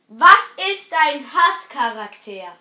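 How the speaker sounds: loud, slow repeat; too loud, headphones on. Real, not acted. Repeats question for headphone listener.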